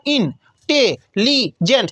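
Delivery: इन टे ली जेंट, four slow separate beats; split into syllables. Shown as a demonstration. Teacher breaking the word into four slow syllables. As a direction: pause after every syllable of इन टे ली जेंट. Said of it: "चार बार में होता है".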